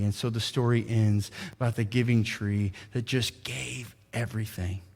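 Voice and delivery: soft, quiet bedtime-story telling, drawing the congregation in; hushed storytelling. A narrator's reading. Pastor retelling a children's story to the church, softly. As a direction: tell it gently like reading to children.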